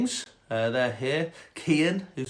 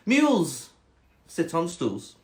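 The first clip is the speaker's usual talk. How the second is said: snobby cat; 3 semitones higher, slower than usual. Acted. Cat refusing the stool, snobby and deliberate. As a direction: refuse snobbily, stretching the first word. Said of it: "quite a snobby cat".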